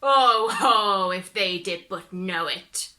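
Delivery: loud, knowing chuckling tone; gloating devil. Acted. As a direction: gloat over the secret.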